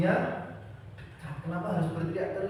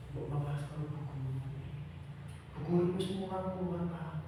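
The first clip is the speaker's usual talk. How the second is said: gentle teacher; 9 dB quieter than usual. Shown as a demonstration. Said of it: "Misalkan ada anak sing salah, cara gampangane".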